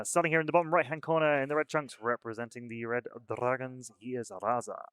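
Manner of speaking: punchy opening settling into a showy name reveal; match announcer introduction. Acted. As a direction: introduce the player like a boxer.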